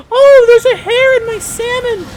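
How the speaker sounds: mock her fussy complaint; mock complaining customer. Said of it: "then she's like".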